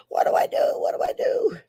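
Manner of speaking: breathy, high mock panic; mock helplessness. Joking about emailing the tech helper, mock helpless. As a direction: plead in a whispery fluster. Acts out emailing Aiden for help.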